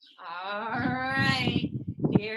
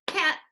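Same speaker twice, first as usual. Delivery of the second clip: "Cat" called out high and loud on one clap; clapped syllable; 7 semitones higher, 3 dB louder than usual. Shown as a demonstration. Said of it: "Let's clap it out".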